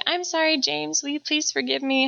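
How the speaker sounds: lightly voiced little boy, sweetly apologetic; a hint of a small child saying sorry; imitating her little son. Mom voices son's apology.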